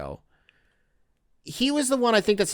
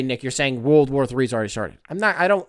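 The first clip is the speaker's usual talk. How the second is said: usual speaking voice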